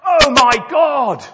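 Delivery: drawn-out high, loud scream for help; theatrical terrified yell; crying out. Acted. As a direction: wail it at full volume. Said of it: "he cried out".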